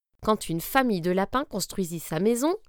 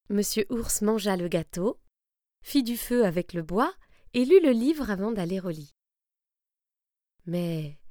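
ordinary delivery, nothing marked

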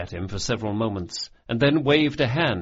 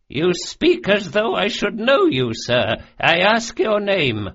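put-on high, raised voice of an old lady of rank; imperious noblewoman; 9 semitones higher, 5 dB louder than usual. A narrator's reading. Old noblewoman demanding a stranger's name, imperiously. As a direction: challenge the stranger in a high, commanding voice. Noblewoman sternly challenging the intruder.

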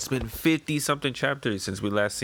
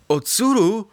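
much higher, louder startled cry of the name; surprised villagers; 10 semitones higher, 6 dB louder than usual. Acted. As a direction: gasp it out in shock.

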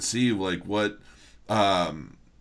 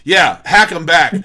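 excited; 6 semitones higher, 10 dB louder than usual. Real, not acted. Gleeful call for counterattack.

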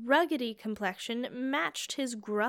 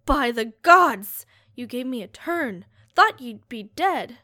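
startled exclamation; 8 dB louder than usual. Acted. Searcher startled when the corpse sits up, loud cry. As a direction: jump back in fright.

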